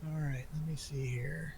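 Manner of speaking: breathy whisper to himself. Real, not acted. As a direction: whisper it under your breath. Muttering to self while checking.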